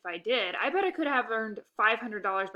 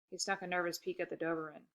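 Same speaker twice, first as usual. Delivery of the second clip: usual speaking voice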